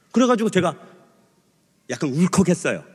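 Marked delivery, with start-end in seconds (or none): none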